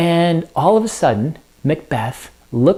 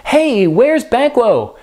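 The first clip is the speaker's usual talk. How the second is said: higher, slow, drawn-out asking; feigned innocent wondering; 8 semitones higher, slower than usual. Acted. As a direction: pretend to wonder where the missing guest is. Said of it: "in another example of deceitfulness".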